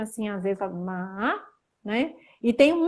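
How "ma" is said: "ma" dips, then rises slowly; dipping third tone. Shown as a demonstration. Teacher showing third tone, "ma" dipping and rising. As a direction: let "ma" sag before lifting it. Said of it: "terceiro tom que vai dar uma caidinha".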